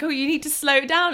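a hint of a relaxed Aussie local; mock Australian drawl. Locals telling her to slow down, lightly mock Australian. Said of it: "people would come up and be like".

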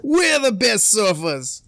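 high, slow Aussie boast; Australian accent. Acted. Australian surfer boasting to Hawaiians, drawn-out Australian accent. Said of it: "How would an Australian sound talking shit".

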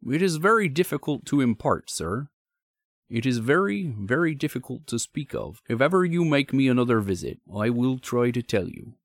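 country signalman confiding reluctantly; troubled signalman. Acted. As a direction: play a troubled, deferential signalman. Signalman's dialogue in narrated story.

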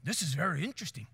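put-on soft, low South African accent; understated safari guide with a South African accent. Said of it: "the South African guy is like".

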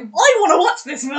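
loud, thrilled outburst; excited exclamation. Real, not acted. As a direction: burst out with eager excitement. Excited by the film description.

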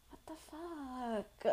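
soft, high, drawn-out mock dismay; hushed, slow retold exasperation; shaken disbelief, acted. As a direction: stretch it out quietly in stunned protest. Acts out her shaken reaction.

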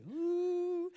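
long, high held "ooh"; carefree whoop. Acted. John chilling on the boat, carefree whoop. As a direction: let out one sustained high hoot.

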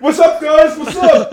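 loud, high-pitched imitation of a reckless buddy; hyped-up mock greeting. Friend imitates Ralph turning round with the gun, hyped-up shout. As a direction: yell it high, like a careless show-off. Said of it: "then he'd be like".

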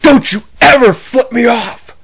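furious uncle's warning, retold; angry uncle. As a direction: scold the kid, slowly and hard. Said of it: "He got so red in the face".

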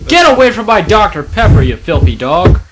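loud, sharp yell at the dog; shouting at dog. Real, not acted. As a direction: shoo the dog off loudly. Yelling at the dog.

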